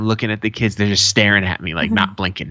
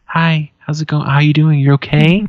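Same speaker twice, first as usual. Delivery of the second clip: gentle dad; 5 semitones higher, 5 dB louder than usual. Retold or imitated. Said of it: "opens the door softly and goes".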